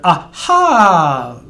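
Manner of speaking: aha with a short first ah and a long held final ah; teacher demonstrating vowel length; short then long ah. Shown as a demonstration. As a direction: stretch the last ah of aha. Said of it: "the short at the beginning and the long at the end".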